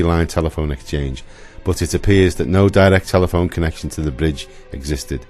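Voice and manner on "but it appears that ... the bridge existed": ordinary talk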